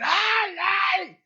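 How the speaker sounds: high, loud yelp of pain; mock cry of pain. Beaten wife cries out to her husband, high pained yelps. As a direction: shriek as if being struck.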